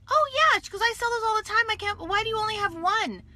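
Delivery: high, light and carefree; breezy new girl. Retold or imitated. The new girl tells coworkers she sells them all the time, breezy and high. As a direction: toss it off like it's nothing.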